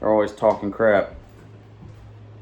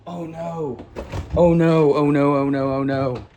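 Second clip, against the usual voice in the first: repeated oh no, startled; a sudden groan at the dog's kill; real alarmed oh no; real dismay. Real, not acted. Dog killing a bird outside.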